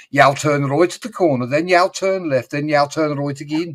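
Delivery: bouncy Birmingham accent, imitating; excitable Brummie accent. Imitating an excitable Brummie giving directions. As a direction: perk it up like an eager Brummie. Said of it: "the excitable Brummie".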